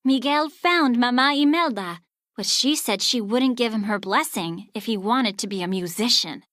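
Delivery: ordinary delivery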